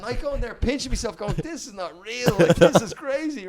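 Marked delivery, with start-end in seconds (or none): 1.4-3.5 amazed disbelief. Retold or imitated. Pinching himself on stage.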